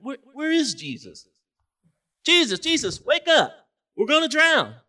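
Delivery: frantic, high and loud cry for help; panicked shout. Acted. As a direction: yell to wake Jesus as the boat sinks. Disciples panic, wake Jesus.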